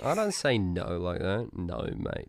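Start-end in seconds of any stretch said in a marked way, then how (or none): 1.5-2.3 low, quiet real Australian accent; Aussie accent. Real, not acted. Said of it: "this is my Aussie accent".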